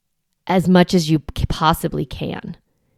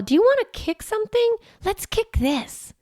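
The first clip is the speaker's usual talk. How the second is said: playful coaxing. Shown as a demonstration. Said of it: "we're gonna be like".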